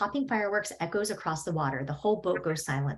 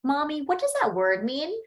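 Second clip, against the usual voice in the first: put-on higher, innocent kid voice asking Mommy; small child; 7 semitones higher than usual. Child asks Mommy about the swear word, higher innocent voice. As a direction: play a curious little kid.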